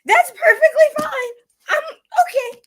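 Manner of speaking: very high, loud, squealing okay; hammed-up high delighted acceptance; mock cheerful acceptance. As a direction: squeal it like everything is fine. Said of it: "I was like".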